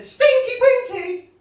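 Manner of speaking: loud, drawn-out high squeal; very high, hammed-up shout; high mock shriek. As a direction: shriek the punchline slowly, in mock-Irish. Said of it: "looked me in the eye and said".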